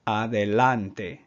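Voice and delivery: "adelante" slow, with the A's open "ah". Shown as a demonstration. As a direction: say "adelante" slowly, A's as in "father". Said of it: "Just focus in on the A sound".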